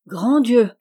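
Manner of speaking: loud startled cry; shocked exclamation. A narrator's reading. Jo cries out, shocked by Laurie's news, aghast. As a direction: blurt it out in shock. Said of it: "s'écria Joe".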